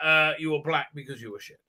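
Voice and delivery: normal talking voice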